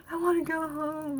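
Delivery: lightly voiced homesick kid; mock inner child. Voicing viewers' homesick inner child, mild mock whine.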